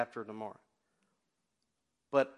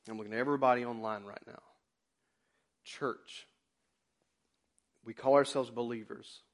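ordinary delivery

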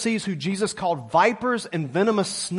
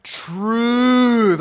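loud drawn-out TRUTH with the congregation; group call of truth; 5 semitones higher, 5 dB louder than usual. Shown as a demonstration. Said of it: "let's all say it together right now".

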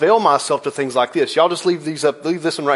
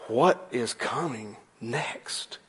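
slow, much softer, lower; suspenseful hush; 6 semitones lower, 12 dB quieter, much slower than usual. Acted. Preacher building suspense from the comic's gasp panel, slow and hushed. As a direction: draw it out in a hushed dread.

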